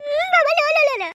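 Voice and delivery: high, teasing, playful complaint; coy wife voice. Acted. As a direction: coo it like a flirting housewife. Wife coos to lover.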